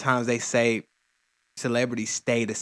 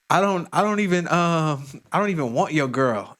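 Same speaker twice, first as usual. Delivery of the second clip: higher, louder mock dismissal; dismissive retort; 5 semitones higher, 4 dB louder than usual. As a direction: brush him off, acting out the other guy. Acting the other guy in the video.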